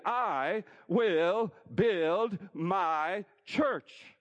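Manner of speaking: very slow, loud, stretched-out promise; very slow proclamation. Acted. Preacher proclaiming Jesus' promise, very slow and loud. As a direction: draw every word out like thunder.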